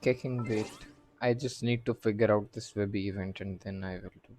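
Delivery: ordinary delivery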